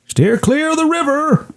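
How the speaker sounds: high-pitched, put-on character voice; bird watcher's warning. Bird watcher warning the cat as she runs off, high mock voice. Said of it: "and he says".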